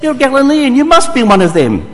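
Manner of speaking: high, loud accusing guard; guard exposes Peter's Galilean accent, loud and high. Acted. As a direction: catch him out by his accent. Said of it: "the guard said".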